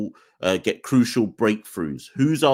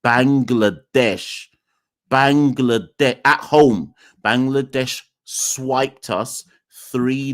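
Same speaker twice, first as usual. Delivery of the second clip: slow and emphatic; slower than usual. Shown as a demonstration. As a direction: spell it out slowly like to a child. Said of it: "say it again slowly for you".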